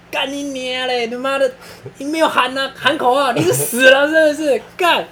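cursing instructor. Retold or imitated. Instructor yelling at a recruit who didn't shout, furious. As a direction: bellow abuse at the silent jumper. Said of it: "然后教官就在下面骂".

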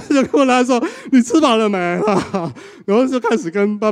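high, loud, laughing through words; laughing while telling. Real, not acted. Preacher retelling the son's nervous call, laughing. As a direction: giggle through the awkward small talk.

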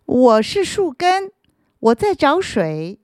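put-on high, polite young voice; polite little root. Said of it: "他礼貌地回答".

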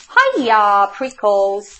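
loud, swooping hail; shouted greeting. A narrator's reading. Jackrabbit dashing by the cactus, shouting. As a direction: holler a greeting in passing. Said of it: "she shouted".

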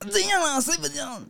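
high mock girlfriend retort; sassy girlfriend. Girlfriend voice mocking the boyfriend's complaint, high. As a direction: snap back in a high whiny voice.

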